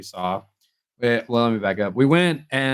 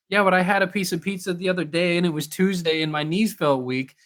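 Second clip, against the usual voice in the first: higher, rambling mock-anxious girlfriend; 7 semitones higher than usual. Boyfriend imitating girlfriend's anxious pizza excuses, higher and rambling. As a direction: lift the voice and ramble nervously. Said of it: "And you're like".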